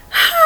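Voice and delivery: very high, gentle ha; soft high ha. Shown as a demonstration. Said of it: "Can just be a soft sound".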